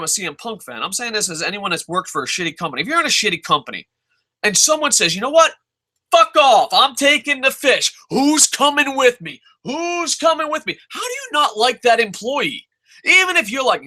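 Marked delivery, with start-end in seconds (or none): 5.1-10.8 high, loud rallying cry of a quitter; raised, punchy call to follow you out; mock defiant quitter. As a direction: act out someone dramatically walking out. Quitter storms out of company.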